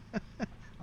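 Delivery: soft unvoiced chuckle; quick airy laugh; breathy laugh. Real, not acted. Laughs at own joke.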